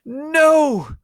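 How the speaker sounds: very high, loud hammed-up scream. Ebony screaming at Draco's blackmail, piercing scream. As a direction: shriek it at the top of your lungs. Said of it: "I screamed".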